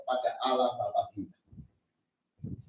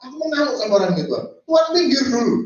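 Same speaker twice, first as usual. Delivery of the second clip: very fast, higher and louder, pushing God aside; impatient outburst; 8 semitones higher, 4 dB louder, much faster than usual. Retold or imitated. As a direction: rattle it off loud and impatient. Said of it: "bahkan dia ngomong". (cepat, keras dan tidak sabar)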